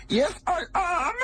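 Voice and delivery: put-on high, slow hammy German villain accent; a hammed-up German villain accent, way up high. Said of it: "Nazi accent very well".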